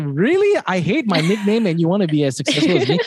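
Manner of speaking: high-pitched, laughing disbelief; mock incredulity. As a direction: start high on a baffled question, speed up into laughter. Retelling his disbelief at admirers.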